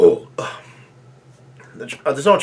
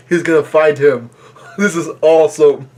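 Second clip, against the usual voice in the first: louder, gleeful fan reaction breaking into a laugh; thrilled; 2 semitones higher, 5 dB louder than usual. Real, not acted. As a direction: cheer it on with a laugh. Delighted reaction to coming fight.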